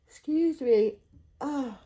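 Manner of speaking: a loud yawn swallowing the apology. Real, not acted. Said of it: "so upset that I yawned".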